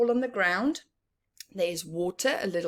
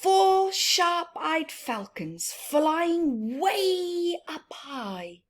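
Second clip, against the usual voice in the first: slow, higher, drawn-out read-aloud with a laugh; storybook reading; 7 semitones higher, slower than usual. Acted. Teacher reading the falcon page aloud, high and slow. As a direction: draw it out high for the children.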